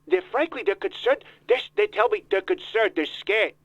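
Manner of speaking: put-on voice of a self-important politician; Donald Trump voice. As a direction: interrupt like a pompous candidate. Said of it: "Mister Trump".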